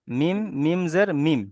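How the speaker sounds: slow, chanted "মিম, মিম জের মিম" with lingering m sounds; each "মিম" drawn out and closed on m; new spelling-out. Shown as a demonstration. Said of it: "উচ্চারণ করার সময় কি করতে হবে".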